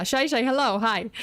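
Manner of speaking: ordinary talk, nothing marked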